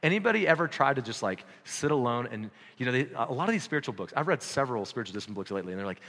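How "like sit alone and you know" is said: normal talking voice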